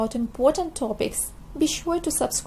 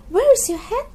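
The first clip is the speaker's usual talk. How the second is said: surprised question; 9 semitones higher, 6 dB louder than usual. Acted. Mother asking, raised.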